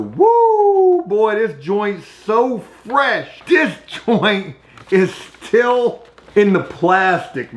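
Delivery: high whoop, then slow drawn-out gloating; mock excited guy. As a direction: play a guy thrilled with his stash, stretching the words. Imitating guy with magazine in shower.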